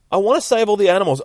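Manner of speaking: high, loud, fast plea; rushed, high-pitched insistence; fervent declaration. Acted. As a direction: declare it loudly in an earnest high voice. Earnest heroine declares her aim.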